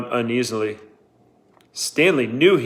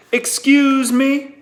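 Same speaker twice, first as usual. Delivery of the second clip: slow, high, louder challenging "Excuse me?"; incredulous excuse me; 14 semitones higher, 5 dB louder, much slower than usual. A narrator's reading. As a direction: stretch it out, daring them to repeat. Warden's sharp incredulous challenge.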